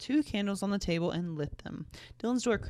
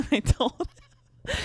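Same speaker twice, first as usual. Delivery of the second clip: words dissolving into a giggle; laughing; 7 semitones higher, 5 dB louder, much slower than usual. Real, not acted. Narrator cracks up at her own story, laughing. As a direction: break up laughing while narrating.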